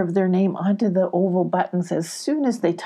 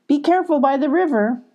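put-on higher, brighter girl calling after her friend; caring call; 8 semitones higher, 3 dB louder than usual. Abby calling after Molly, higher girl's voice. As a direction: send her off with a gentle caution.

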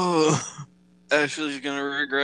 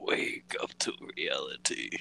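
breathy whispered menace; eerie whisper; 12 semitones lower, 10 dB quieter than usual. Pretend. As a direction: whisper it like a ghost. Whispered repeat of quote.

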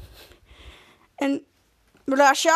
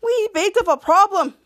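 raised-pitch mock Scottish; higher, louder imitated Scottish accent; 5 semitones higher, 5 dB louder than usual. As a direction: retell it as a Scottish newscaster. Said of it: "And Scottish Trent said".

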